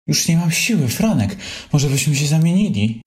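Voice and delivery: high, winded whine; complain, out of breath from pulling; breathless complaining. Acted. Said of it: "narzekał zziajany Królik". (zziajane narzekanie, wysoko)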